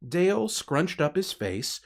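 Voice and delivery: plain reading voice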